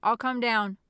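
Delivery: loud call downward; yelled reply. A narrator's reading. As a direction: project it to someone below. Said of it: "Jeremy yelled".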